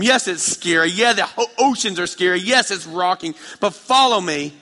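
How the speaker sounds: loud, high, urgent sermon delivery; full preaching. Real, not acted. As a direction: drive the invitation home with fire. Preaching invitation, building.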